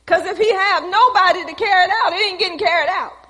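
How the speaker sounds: preaching emphasis. Acted. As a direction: press the point on the church.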